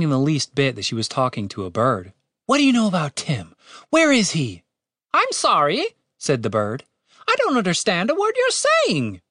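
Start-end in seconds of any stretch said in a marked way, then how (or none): 2.5-4.6 raised pitch, pressing questions; insistent, higher-pitched questioning; urgent demand. A narrator's reading. Catherine urgently demands news of Tim. 5.1-5.8 high-pitched quick bird apology; high little bird, conversational; polite talking bird. A narrator's reading. Bird's usual voice, before slow repeat. 7.3-9.2 high-pitched bird voice; puzzled little bird, pitched way up; baffled talking bird. A narrator's reading.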